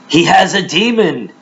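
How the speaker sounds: high-pitched accusers' voice; jeering crowd. Acted. Crowd accusing Jesus of madness, high jeering voice. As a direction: call out the accusation like a scornful crowd.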